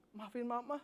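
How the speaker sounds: hammed-up soap-opera mom, softly; mock tearful apology, lightly played; melodramatic mom. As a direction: overdo the apology like a sinetron mother. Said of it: "melebay-lebaykan".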